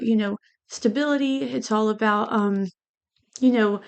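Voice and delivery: usual speaking voice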